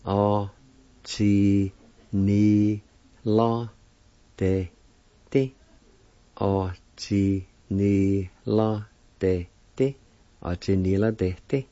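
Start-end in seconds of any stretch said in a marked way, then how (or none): 0.0-5.5 O chi ni la de ti said syllable by syllable with pauses; breaking the Cherokee word into syllables; syllables one by one. Shown as a demonstration. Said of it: "pronounced like this". 6.4-10.0 O chi ni la de ti said slowly and joined, rising on ti; slow model of the whole word. Shown as a demonstration. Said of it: "pronounced like this".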